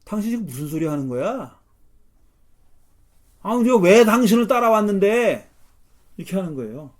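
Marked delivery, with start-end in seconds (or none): 0.0-5.5 indignant outburst, swelling into a loud, higher cry; protesting like a betrayed follower; acted angry protest. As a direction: build from challenge to shouted complaint. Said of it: "예수님께 일종의 폭력을 행사한 거예요".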